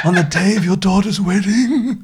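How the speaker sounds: laughing jaw-out impression; David Bellamy voice. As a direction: push your jaw out, slow underbite voice. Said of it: "like your David Attenborough impression".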